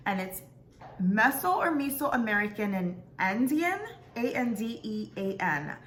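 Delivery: ordinary talk, nothing marked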